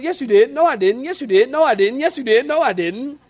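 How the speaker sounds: high, loud playground squabble; bickering back and forth. Acted. Preacher acting out God and Sarah bickering, high and loud. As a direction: trade the lines fast like squabbling kids. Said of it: "She goes".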